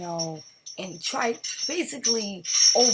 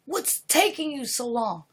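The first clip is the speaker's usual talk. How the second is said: rising rich guy's impatient yell, higher and louder; angry demand; 6 semitones higher, 6 dB louder than usual. Acted. Rich customer yelling at the cook for food. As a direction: bark at the cook like a spoiled rich man.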